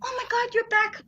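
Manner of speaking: high, hushed mock-tearful gasp of reunion; soap opera actress. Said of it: "I know how some opera actresses be like".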